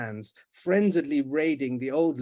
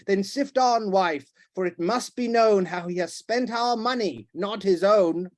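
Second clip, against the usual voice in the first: loud, higher tirade; raging harangue; 6 semitones higher, 5 dB louder than usual. Acted. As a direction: lecture her in fury.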